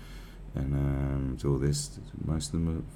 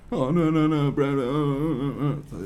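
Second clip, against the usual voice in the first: mock backing down; 8 semitones higher, 4 dB louder, slower than usual. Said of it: "backing down tough guy".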